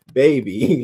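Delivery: usual speaking voice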